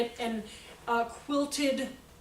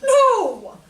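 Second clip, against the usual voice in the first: high, loud exclamation; loud, emphatic no; 10 semitones higher, 13 dB louder than usual. Real, not acted. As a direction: fling out the no. Emphatic answer to her question.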